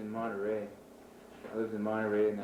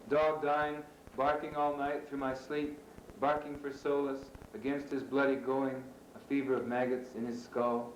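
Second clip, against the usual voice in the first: higher-pitched, measured recital than casual chat; poem reading; 5 semitones higher than usual. Acted. Poet reading a poem on a dying dog to listeners, measured recital. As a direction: deliver each image as its own phrase.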